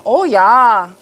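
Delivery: playful mock German accent; drawn-out "Oh, yeah" with a German accent; best German accent. Streamer savoring the satisfying job, in a mock German accent. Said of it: "In my best German accent".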